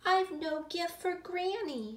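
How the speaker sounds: put-on high-pitched little girl's lament; sighing dismay. A narrator's reading. Gilly has nothing for Granny, high sighing dismay. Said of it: "she sighed".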